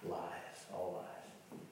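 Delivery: breathy near-whisper; almost whisper it; hushed aside: all lies. Real, not acted.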